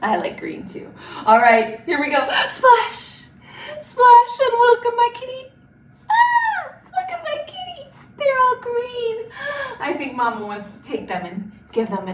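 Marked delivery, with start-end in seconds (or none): 2.5-5.5 high, loud playful call; excited splash. Pretend. Teacher dunks the last kitty for children, excited and loud. Last kitten jumps into paint. 6.1-9.3 very high pretend astonishment; amazed reveal. Teacher shows children the green kitty, high amazement.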